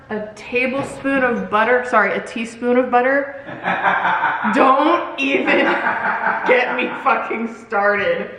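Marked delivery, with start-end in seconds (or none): 3.5-8.3 slow, words broken up by laughter; helpless laughter through the words; laughing. Real, not acted. Creator loses it over a spoon slip, laughing.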